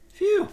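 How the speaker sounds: a sharp, high breath of relief; relieved phew. Real, not acted. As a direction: blow out relief as the scare passes. Relief after a scary mistake.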